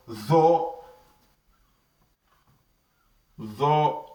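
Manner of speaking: "Tho" with a heavy th from the throat; heavy, throaty "Tho"; emphatic letter. Shown as a demonstration. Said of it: "they are emphatic letters".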